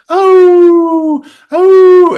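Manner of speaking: high, loud imitated wail; two long, high-pitched howls; Marilyn Burns screaming. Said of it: "Marilyn Burns with the screaming".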